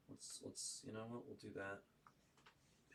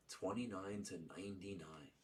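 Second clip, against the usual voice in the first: quiet repeat; 6 dB quieter than usual. Real, not acted. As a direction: murmur the number back to yourself.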